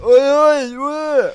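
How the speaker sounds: imitating rowdy coked-up roofers, shouted high; laddish yell. Mimicking the roofers heard from the green room, hammed-up yell. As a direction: bellow it like a lad in the crowd. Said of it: "Just like".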